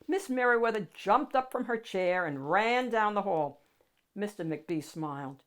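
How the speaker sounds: ordinary delivery, nothing marked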